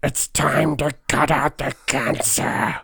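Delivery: slow, put-on catchphrase delivery. Friend asks him to do his catchphrase, slow put-on voice. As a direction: deliver the line like a film villain, slowly. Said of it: "do the, uh, catchphrase again".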